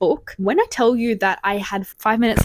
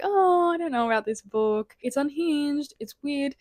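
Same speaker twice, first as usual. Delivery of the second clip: doubtful past self; 7 semitones higher than usual. Retold or imitated. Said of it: "you can see I'm kinda like".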